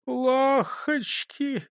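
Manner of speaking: high, very slow whimper; weak, woeful little voice; faint babble. A narrator's reading. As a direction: stretch it out feebly. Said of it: "пролепетал Густав Гейер".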